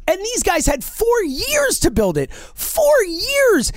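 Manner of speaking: high-pitched, emphatic indignation; exasperated outrage. Real, not acted. Host venting at the disastrous farm system, exasperated.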